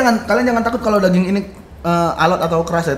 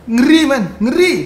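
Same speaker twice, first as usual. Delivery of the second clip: excited; 7 semitones higher, 7 dB louder, slower than usual. Real, not acted. Raving about the food's aroma.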